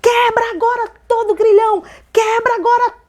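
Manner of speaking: high, forceful commanding shouts; deliverance prayer shout. Retold or imitated. Woman praying with hand on her chest, shouted deliverance. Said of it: "fazer uma oração de libertação".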